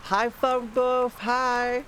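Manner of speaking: bright squeaky hi, stretched out; cutesy greeting. Real, not acted. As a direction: coo a welcome to a favourite viewer. Greeting a viewer in chat.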